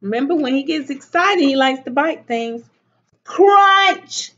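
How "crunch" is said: high, loud CRUNCH; sudden storybook chomp word. A narrator's reading. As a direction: snap out the bite as a sound effect. Big bite in the story.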